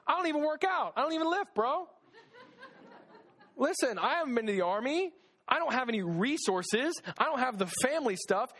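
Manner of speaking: whiny excuses. Retold or imitated. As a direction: protest like a nervous gym-shy guy. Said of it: "But Gideon's saying".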